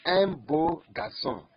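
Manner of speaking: Un beau garçon said slowly and loudly; slow model phrase. Shown as a demonstration. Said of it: "Look at this example".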